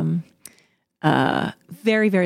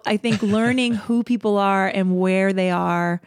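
ordinary talk, nothing marked

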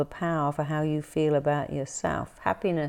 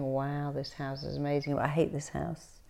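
much softer muttered aside; under my breath; 8 dB quieter than usual. Retold or imitated. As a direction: mutter it to yourself. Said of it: "I was going".

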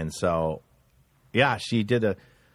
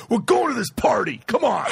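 high, pushy mock shout; hammed-up impatient boyfriend; bossy insistence; 8 semitones higher than usual. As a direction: order your girlfriend out the door. Boyfriend dragging girlfriend to party.

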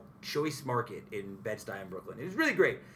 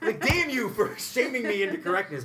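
much higher, louder, laughing mock frustration; mock grudging curse; 11 semitones higher, 6 dB louder than usual. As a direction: curse the barista for being right, laughing. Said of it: "I was like".

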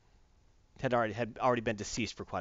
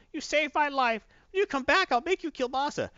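put-on very high-pitched grateful old lady; put-on little old lady, pitched way up; old woman; 13 semitones higher, 3 dB louder than usual. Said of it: "you see an old woman like".

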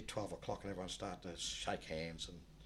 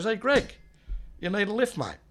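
loud, high call across the street; 12 semitones higher, 12 dB louder than usual. Retold or imitated. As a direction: shout it over to a mate by the road. Calling to mate from car.

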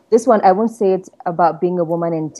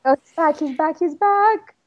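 excited; 11 semitones higher than usual. Real, not acted. Colleague rejoins the call.

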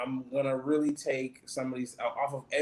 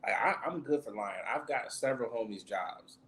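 ordinary talk

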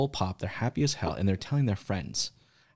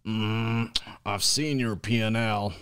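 slow, gruff character voice; drawn-out badger growl, lightly voiced; slower than usual. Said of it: "I'm gonna use my, uh, my Badger voice".

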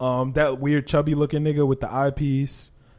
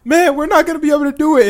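high, hammed-up whiny students; mock their defeated whining; 12 semitones higher, 6 dB louder than usual. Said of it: "they were like".

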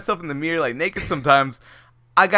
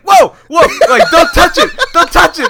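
much higher and louder laughing mock yell; alarmed doctor yell; 12 semitones higher, 10 dB louder than usual. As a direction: imitate the panicked doctor shouting "don't touch it".

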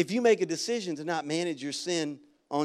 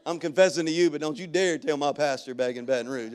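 ordinary talk, nothing marked

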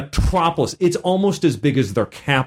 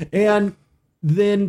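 usual speaking voice

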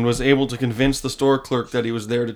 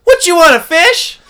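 put-on voice; 14 semitones higher, 8 dB louder than usual. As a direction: ham up a strange clerk offering fish. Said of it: "I'm gonna do this voice".

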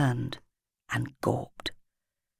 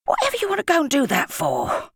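lollipop man; 10 semitones higher than usual. A narrator's reading. Lollipop man scolding the boy.

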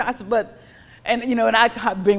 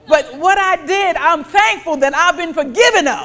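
louder and higher, driving each phrase home; impassioned preaching; 7 semitones higher, 10 dB louder than usual. Acted. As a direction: preach it out to the congregation. Preaching at full force.

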